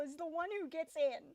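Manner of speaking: loud, indignant yell; outraged shout. Real, not acted. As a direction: shout it in disbelief. Outraged at the temple destroyer.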